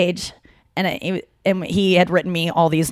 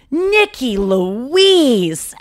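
higher, very slow, drawn-out teasing "Nikki Louise"; Dave Spade's slow drawl; 5 semitones higher, 3 dB louder, much slower than usual. Retold or imitated. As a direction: stretch the name out like a teasing older friend. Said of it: "Just to make my middle name Louise was so funny".

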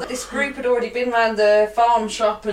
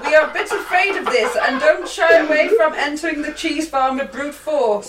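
higher, louder mock Dutch accent; attempted Dutch accent; 5 semitones higher, 4 dB louder than usual. Host quotes a frightened Dutch farmer, botched Dutch accent. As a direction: put on a bad Dutch accent, half laughing. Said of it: "was trying to do Netherlands".

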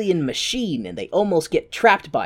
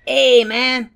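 higher, louder street-dog swagger; a hint of a cocky Chihuahua; 7 semitones higher, 5 dB louder than usual. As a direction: bark it like a cocky little dog. Voicing the Chihuahua.

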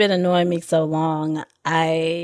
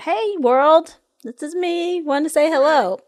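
cheerful poster; 8 semitones higher, 4 dB louder than usual. Retold or imitated. Imitating a Facebook post.

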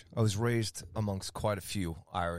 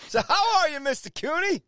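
much higher, loud showman greeting; big hype greeting; 7 dB louder than usual. Real, not acted. Host greeting his co-host, loud and high showman style. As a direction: greet him like a game-show host.

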